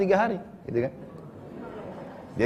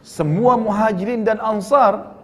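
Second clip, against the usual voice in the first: higher, drawn-out incredulous question; mock astonishment; 6 semitones higher, 4 dB louder, slower than usual. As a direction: echo it slowly in acted disbelief. Jabir's shocked reply, acted. (menirukan kaget tak percaya, nada tinggi)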